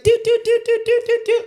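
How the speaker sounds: high, repeated TV zoom-in beeps; imitated zoom-in sound. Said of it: "like, zoom in different people and".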